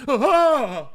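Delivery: loud, high mock gasping scream; startled scream. Girlfriend startled as he walks into the room, startled mock scream. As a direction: let out a sudden terrified "ah". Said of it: "she will inevitably go".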